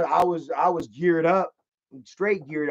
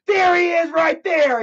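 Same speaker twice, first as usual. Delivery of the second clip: high loud shout while pointing; pointing him out with a yell; 11 semitones higher, 5 dB louder than usual. Retold or imitated. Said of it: "And he points at me".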